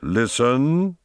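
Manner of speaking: menacing master demanding attention. A narrator's reading. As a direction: threaten with a single word, drawn upward. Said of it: "tone full of dreadfully quiet menace".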